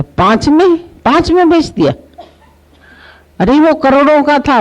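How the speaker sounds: can't believe what he hears; shocked disbelief. Retold or imitated.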